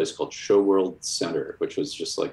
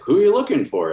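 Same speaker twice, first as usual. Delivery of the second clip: doorman asking; 11 semitones higher, 7 dB louder than usual. Retold or imitated. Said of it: "And he was like".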